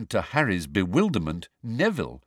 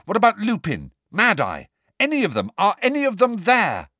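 higher, louder, pressing; urgent questions; 11 semitones higher, 4 dB louder than usual. A narrator's reading. Harry interrogates the elf about the others, urgent. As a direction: fire the questions at him.

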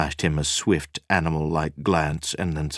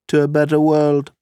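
toasting; 10 semitones higher, 8 dB louder than usual. Acted. Nina's toast.

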